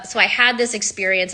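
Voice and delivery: ordinary delivery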